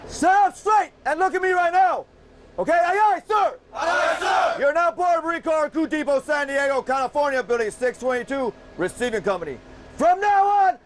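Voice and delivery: high, very loud screaming orders; drill instructor screaming. Real, not acted. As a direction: scream every word at recruits.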